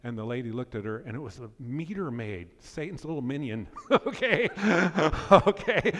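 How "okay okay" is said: high, loud laughing okay; preacher cracking up at her own joke, loud laughing. Real, not acted. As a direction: laugh right through it. Laughing at Satan's minion joke.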